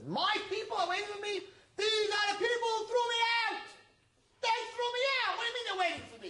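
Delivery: the exiled king shouts, stung and betrayed; outraged disbelief. Acted. Exiled king shouting back at the wise man, outraged. King hears people want him.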